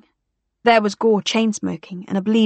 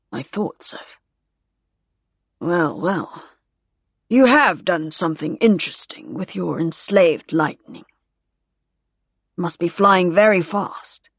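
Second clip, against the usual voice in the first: musing officer. A narrator's reading. Gore musing on the plane.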